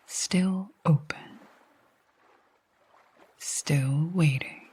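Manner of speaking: calm meditation voice with long pauses; guided sleep hypnosis. Acted. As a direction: guide them gently to sleep, leaving long silences between phrases.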